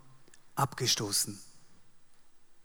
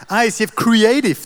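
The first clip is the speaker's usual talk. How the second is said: proud hype; 7 semitones higher, 11 dB louder than usual. Pretend. Proudly showing off his team.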